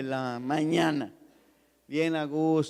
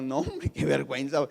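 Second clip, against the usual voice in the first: ordinary delivery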